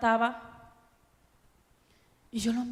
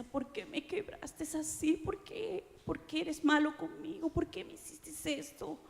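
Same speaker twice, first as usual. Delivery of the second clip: tearful plea; 6 semitones higher than usual. Retold or imitated. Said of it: "Y lloraba y le decía".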